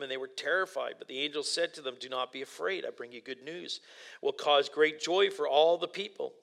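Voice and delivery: soft, measured Bible reading voice; scripture reading. Acted. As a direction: read the passage gently to the congregation. Reads scripture aloud.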